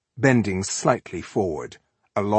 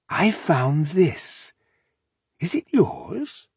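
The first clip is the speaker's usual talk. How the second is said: high, slow, lilting question; feigned innocent taunt; 9 semitones higher, much slower than usual. A narrator's reading. Elf taunting rebel with intercepted letter, mock innocent. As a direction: dangle it with slow mock politeness.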